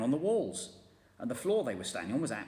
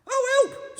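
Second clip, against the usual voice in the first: very high, loud scream; 12 dB louder than usual. A narrator's reading. Missus Twit screaming at the upside-down room. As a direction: shriek in shock. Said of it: "screamed Missus Twit".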